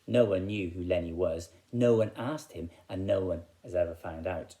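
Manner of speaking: ordinary delivery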